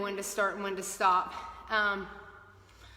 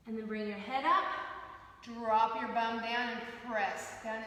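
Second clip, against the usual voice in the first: calm yoga instruction; 8 dB quieter than usual. Acted. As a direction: guide the stretches calmly. Leads a yoga class.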